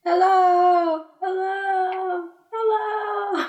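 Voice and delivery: shouting into the dark cave, echo fading; calling into a cave. Acted. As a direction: call out, then let it die away as an echo. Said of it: "You call, but only your own voice echoes back".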